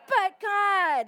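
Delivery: But God shouted, high and loud; call and response. Acted. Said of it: "Can y'all say that?".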